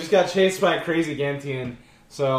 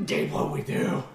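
whispering; 9 dB quieter than usual. Acted. Scared whisper.

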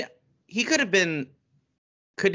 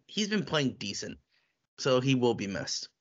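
ordinary talk

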